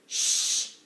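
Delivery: sharp loud hush; shushing. Acted. Rat hushing servant girl on secret stairs, sharp shush. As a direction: cut her off with a shush.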